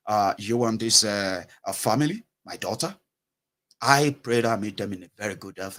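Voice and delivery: low, composed, polite prayer; changed prayer intonation. Retold or imitated. Parent abroad praying before a journey, low and composed. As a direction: pray calmly on a low, even note. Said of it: "the intonation is also going to change".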